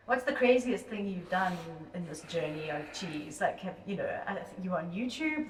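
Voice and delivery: distant, muffled voice from far off; cave echo. Real, not acted. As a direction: sounds recorded in a cave. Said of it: "if I sound a little bit distant and like I'm in a cave".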